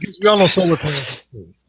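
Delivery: mock-obvious sarcasm with a laugh; facetious. Real, not acted. Ribbing co-host about cheap electric car owners, facetious. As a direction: say it facetiously, amused and quick. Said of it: "that's me being facetious".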